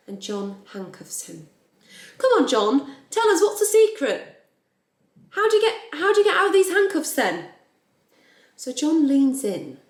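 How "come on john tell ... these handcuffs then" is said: loud, very high-pitched pestering; hammed-up high, insistent questioning; eager lad, acted. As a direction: play an excited young lad demanding the secret. Acting the handcuffed lad egging John on.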